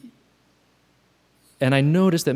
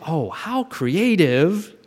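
slow, lilting, pitched-up self-praise; playfully impressed, stretched out; mock admiration; 5 semitones higher, much slower than usual. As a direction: marvel at yourself, drawing it out. Admires own invented story.

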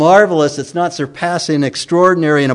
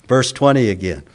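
usual speaking voice

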